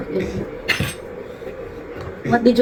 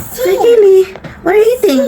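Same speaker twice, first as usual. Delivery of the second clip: excited calling; 8 semitones higher, 5 dB louder, faster than usual. Real, not acted. Mother calling to her toddler.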